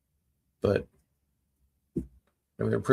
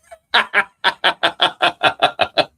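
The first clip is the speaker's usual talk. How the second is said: loud, high, long burst of laughter; big laugh; 8 semitones higher, 8 dB louder than usual. Real, not acted. Streamer cracking up at own joke, long laugh. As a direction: laugh hard in a rapid descending run.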